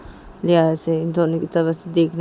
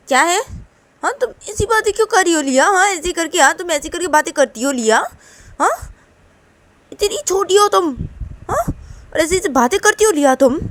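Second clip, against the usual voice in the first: loud, high-pitched telling-off; scolding mother; 10 semitones higher, 4 dB louder than usual. Acted. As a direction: demand an apology from a cheeky child. Mother scolds daughter for rudeness. (ज़ोर से डाँटती माँ)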